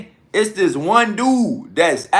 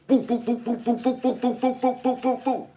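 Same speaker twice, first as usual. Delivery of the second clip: soft, higher boop-boop rapid fire; spray imaginary bullets with your mouth; mouth machine gun; 6 semitones higher, 6 dB quieter than usual. Retold or imitated. Imitating rapid fire as they hop out.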